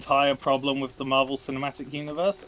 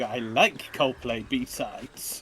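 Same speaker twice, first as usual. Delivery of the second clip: very slow; 3 semitones lower, much slower than usual. Retold or imitated. Mocking fan, very slow.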